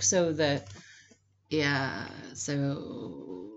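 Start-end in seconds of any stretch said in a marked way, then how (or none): 1.5-3.5 low, drawn-out mock growl; vocal growl. Shown as a demonstration. Closet linguist showing a hated habit, low growly voice. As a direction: let it sink into a soft, low growl. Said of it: "hate the growl".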